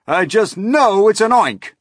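high, loud, panicked insistence; screamed alarm. A narrator's reading. Fire chief convinced of a monster, panicked scream. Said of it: "screamed the head of the fire department".

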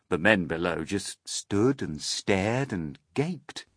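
ordinary delivery

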